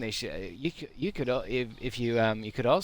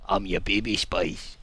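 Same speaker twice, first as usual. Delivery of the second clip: low put-on character voice; deep mock introduction; 5 semitones lower than usual. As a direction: ham it up in a low voice.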